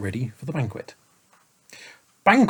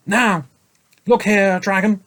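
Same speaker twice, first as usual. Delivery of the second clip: firm, raised scolding voice; firm saint; 9 semitones higher, 6 dB louder than usual. A narrator's reading. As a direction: lay down the law. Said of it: "said the saint firmly".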